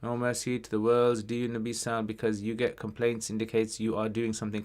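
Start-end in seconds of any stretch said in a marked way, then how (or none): none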